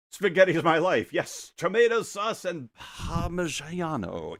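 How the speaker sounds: lightly hammed-up Italian accent, high; lightly hammed-up Italian accent with a rolled Parmigiano; mock Italian accent. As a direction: play a jolly Italian chef. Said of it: "forgive my poor American Italian pronunciation".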